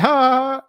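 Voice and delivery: high, loud imitated awkward laugh; put-on high-pitched "ha ha"; mock laugh back. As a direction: echo a laugh in falsetto, cluelessly. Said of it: "I was like".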